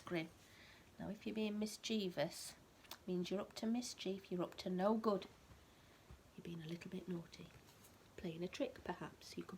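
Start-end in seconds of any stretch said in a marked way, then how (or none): none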